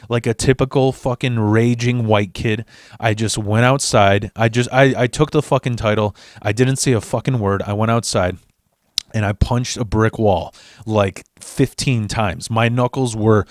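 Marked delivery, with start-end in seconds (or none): none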